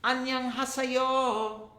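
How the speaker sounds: Annyeonghaseyo drawn out slowly, syllable by syllable; slow careful greeting. Shown as a demonstration. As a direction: model Annyeonghaseyo slowly for kids. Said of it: "Let's go to Korea".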